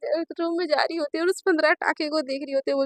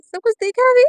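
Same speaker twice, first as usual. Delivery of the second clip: high, louder, aghast; mortified question; 7 semitones higher, 7 dB louder than usual. Acted. Naina asking her parents if they saw everything, mortified. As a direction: squeak out the horror.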